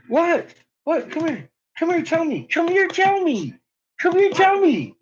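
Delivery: high, falling pet-talk voice; coaxing dog talk. Real, not acted. Owner coaxes dog to come and tell, high pet voice. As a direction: coax the dog in a high singsong.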